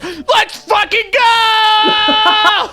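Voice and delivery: high, drawn-out screaming hype; high slow roaring celebration; hype shout. Retold or imitated. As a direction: scream it like a streamer losing it over a trick. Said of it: "he goes".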